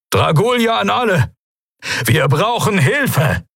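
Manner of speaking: high-pitched distress call; urgent call for help. Acted. Admiral to all ships for help, urgent and high.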